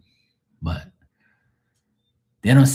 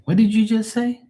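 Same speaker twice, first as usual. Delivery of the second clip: much higher incredulous voice; shocked client; 12 semitones higher than usual. Acted. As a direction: react aghast, pitched way up.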